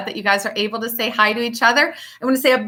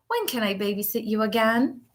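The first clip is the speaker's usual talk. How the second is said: soft hopeful question; 8 dB quieter than usual. Acted. Child asks at goodbye.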